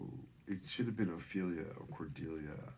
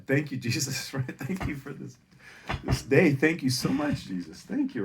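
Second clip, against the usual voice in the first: high, loud gushing gratitude; ecstatic thanks; 6 semitones higher, 9 dB louder than usual. Retold or imitated. Comic thanking Jesus, high and loud with joy. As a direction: gush thanks to Jesus, overjoyed.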